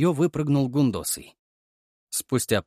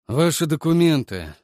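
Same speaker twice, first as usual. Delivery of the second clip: gloomy; 5 semitones higher, 6 dB louder than usual. A narrator's reading. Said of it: "хмуро бросил человек".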